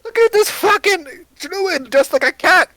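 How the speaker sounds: very high, loud, slow indignant yell; outraged shout. Acted. Dwarf raging to the magician about the cat. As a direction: blow up at her. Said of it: "looks at you and goes".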